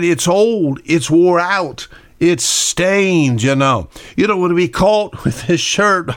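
scolding wife, half-hearted; imitating the wife dismissing the shirt; wife's voice. Wife voiced in retold story.

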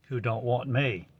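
ordinary talk, nothing marked

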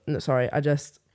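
ordinary delivery

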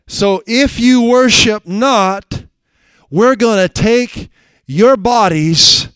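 loud, high, slow preacher's reading of the king's threat; fiery sermon delivery; preaching at full force. Acted. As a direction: thunder out the scripture.

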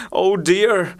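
a mild, understated moan of "Oh dear,". A narrator's reading.

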